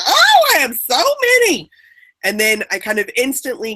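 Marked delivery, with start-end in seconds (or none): 0.1-1.7 much higher, louder and hammed-up, falling on oh; a jokey burst of pride at having so many examples; mock delight. Playing up her own delight at the workshop, in a hammed-up high voice. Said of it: "went to some humor place of like".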